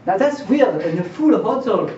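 usual speaking voice

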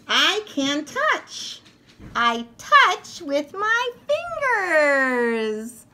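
proud little toy showing off; toy's voice. Acted.

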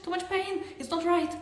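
high, loud, frantic fear of the pain; frightened inner voice shrieking to stop; panicked inner voice. Retold or imitated. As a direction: squeal it out like a panicking body. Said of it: "and you're like".

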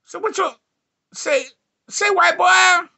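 high-pitched drawn-out calling; mock pickup holler. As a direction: holler it high, endings sliding down. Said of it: "I be like".

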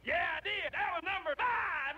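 high, loud comeback; high-pitched triumphant punchline; gleeful crowing. Acted. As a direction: crow it, about to crack up. Crowing callback to the joke.

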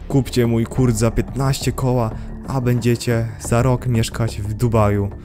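ordinary talk, nothing marked

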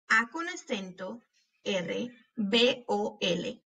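slow, letter by letter; spelling out. Shown as a demonstration. Teacher spelling árbol for pupils, letter by letter. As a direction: pause between the letter names. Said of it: "cuando estamos deletreando estas palabras".

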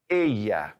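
ella said as eh-ya, the double L like ya; Spanish ella. Shown as a demonstration. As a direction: say ella with a ya sound in the middle. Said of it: "double L sounds like ya".

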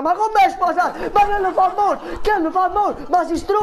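high, loud scandalised yelling; outraged shout. Acted. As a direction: blow up in mock outrage. Outraged at married man's answer.

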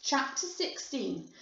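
ordinary delivery

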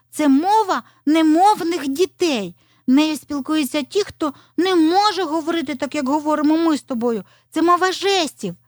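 put-on high child-like fox explaining; pitched well up, explain slowly and keenly; high-voiced earnest young fox; eager little fox.

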